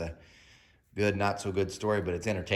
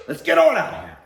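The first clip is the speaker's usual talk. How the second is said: much higher, loud order; mom storming out; 10 dB louder than usual. Retold or imitated. Mom leaving the store with the thief, loud. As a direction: bark it on the way out.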